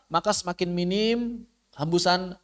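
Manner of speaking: ordinary delivery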